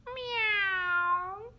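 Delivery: long, high, loud meow; imitated cat screech. A narrator's reading. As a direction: wail like a yowling cat. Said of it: "the sound that Bob made was this".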